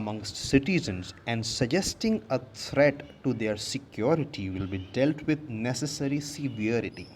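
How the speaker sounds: slow, careful dictation of the tweet; slow classroom reading; slow read-aloud. Shown as a demonstration. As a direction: read each word out slowly for learners. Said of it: "reads the tweet aloud slowly".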